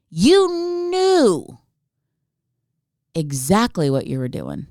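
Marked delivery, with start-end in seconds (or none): 0.1-1.6 high drawn-out accusation; comic high-pitched indignation; mock outrage. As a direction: wail the accusation up high, stretched out. Ranting at the absent man.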